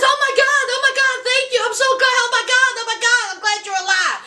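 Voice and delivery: high, breathless, overjoyed; gushing with relief, pitched high; excited relief. Retold or imitated. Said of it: "And she says".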